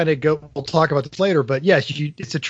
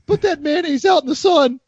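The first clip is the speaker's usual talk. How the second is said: put-on high cracking teen voice; squeaky teenager voice; 12 semitones higher, 4 dB louder than usual. Voicing the squeaky Simpsons teenager.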